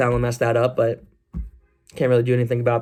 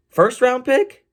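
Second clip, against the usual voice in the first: much higher, rising disbelief; can you believe that price; shocked question; incredulous; 11 semitones higher, 3 dB louder, much slower than usual. Real, not acted.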